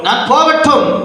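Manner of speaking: slow, ringing pulpit reading; declaimed scripture. Real, not acted. Preacher repeats the verse after the reader, slow and declamatory.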